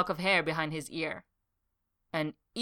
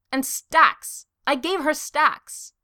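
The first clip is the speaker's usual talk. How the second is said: loud and high, slow insistent wail; a wounded suitor insists he gave her gifts; desperate protest; 9 semitones higher, 8 dB louder, much slower than usual. Acted. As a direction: plead your case at full volume. Desperate protest about his gift.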